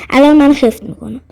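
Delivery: usual speaking voice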